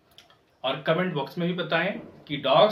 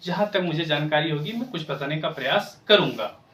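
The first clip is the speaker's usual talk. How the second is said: usual speaking voice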